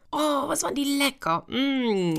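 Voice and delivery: high, delighted lip-smacking voice; relishing the taste. Acted. Jumbo savoring the sweet cakes he just ate, high and delighted. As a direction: swoon over how tasty the cakes were.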